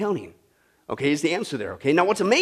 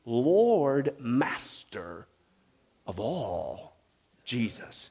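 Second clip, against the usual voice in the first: much softer and lower, in awe; hushed reverence; 9 semitones lower, 9 dB quieter, much slower than usual. Real, not acted. Preacher answering his own question in hushed worship. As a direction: drop to a reverent near-hush.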